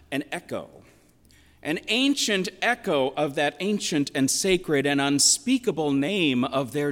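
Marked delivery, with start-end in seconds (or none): none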